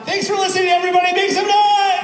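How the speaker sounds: high, shouted crowd hype; big excited show-closing yell; wild sign-off shout. Pretend. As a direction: rouse the crowd at full voice. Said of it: "please go wild with excitement".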